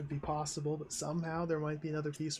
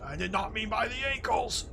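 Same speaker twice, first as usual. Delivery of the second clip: higher, exasperated scold; indignant rebuke; 5 semitones higher, 3 dB louder than usual. Acted. Boss scolds Rhodey over the hung medic, indignant. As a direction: scold the staff for their blunder.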